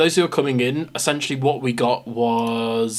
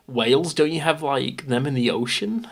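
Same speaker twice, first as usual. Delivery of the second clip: lightly mocking American; mock confused American; 3 semitones higher than usual. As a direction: play a clueless foreigner.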